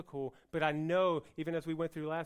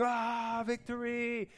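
mock battle cry; 5 semitones higher, slower than usual. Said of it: "they're screaming".

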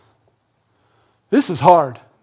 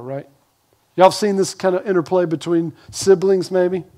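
ordinary delivery

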